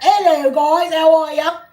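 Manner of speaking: high, loud put-on British greeting; fake London accent. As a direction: belt out a mock London hello. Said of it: "me moving to London tomorrow".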